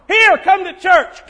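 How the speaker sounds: loud, high, grudging shove of an invitation; curt forced invite. Retold or imitated. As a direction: bark the invite to get it over with. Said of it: "we can get there".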